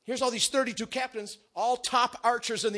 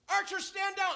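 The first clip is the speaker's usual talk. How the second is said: called-out order; 11 semitones higher than usual. Acted. Replays the captain's order.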